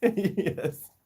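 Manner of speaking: high yes through a laugh; amused and high-pitched; laughing reply. Real, not acted. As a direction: giggle out the answer.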